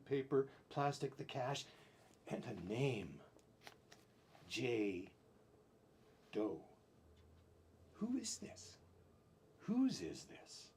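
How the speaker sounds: ordinary talk, nothing marked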